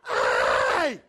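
sudden loud yell across the yard; big yell. Retold or imitated. Farmer yelling at trespassers by his pond, loud yell. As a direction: bellow to scare off trespassers. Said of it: "I let out this big old yell".

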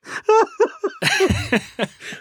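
high-pitched laughing fit; long high laugh. Real, not acted. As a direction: let out a long squealing laugh. Laughing at joke.

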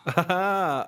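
very slow mimicked "ah, ha, ha"; soundbite impression. Showing the podcast an impression, imitated laugh. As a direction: copy the soundbite's stretched laugh. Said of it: "showed the podcast my impressions yet".

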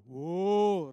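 a held, resonant mock "Åh" sound; deep åh voice. Gravedigger shows his booming voice with an "Åh". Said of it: "hadde en sånn ... røst".

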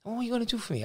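a hint of a broad Leicester accent. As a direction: imitate the gruff Leicester owner, lightly. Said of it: "broad Leicester accent".

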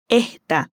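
"esta" said as "ehta", the S breathed out; aspirated S. Shown as a demonstration. Teacher copying the waiter's accent: "esta" with an aspirated S. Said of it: "aspira la S en esta y dice así".

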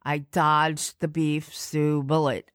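normal talking voice

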